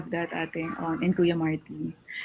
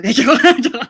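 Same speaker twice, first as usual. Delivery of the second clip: laughing; 4 semitones higher, 10 dB louder than usual. Real, not acted. Teasing host laughs it off.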